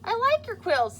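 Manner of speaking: high, loud, bright-hearted little bear; cheerful bear friend. Acted. Bear friend comforts Pokey.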